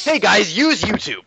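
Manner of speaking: mock promo voice, drawn out; mock YouTuber pitch. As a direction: sell it like an annoying YouTuber. Parody of annoying channel ad.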